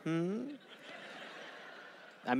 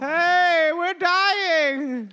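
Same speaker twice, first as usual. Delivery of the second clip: mock dying survivor; 8 semitones higher, 3 dB louder, much slower than usual. Survivor calling to rescuers.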